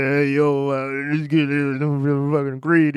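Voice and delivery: mumbled, slow tough-guy voice; lightly voiced mumbling Stallone; drawn-out mock Stallone grumble; Stallone impression. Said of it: "he just mumbles through".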